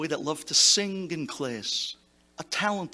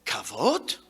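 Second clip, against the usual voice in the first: low questioning echo; 8 semitones lower than usual. Retold or imitated. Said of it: "He stopped".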